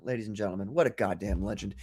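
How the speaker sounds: normal talking voice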